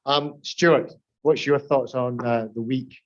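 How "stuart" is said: ordinary talk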